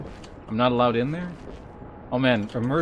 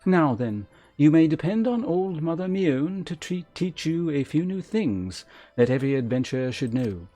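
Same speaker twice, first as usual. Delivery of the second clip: slightly higher, measured storybook delivery; stately elder game character; 5 semitones higher, 3 dB louder than usual. Acted. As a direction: read as a gracious guild elder welcoming a newcomer. Reads game character dialogue aloud.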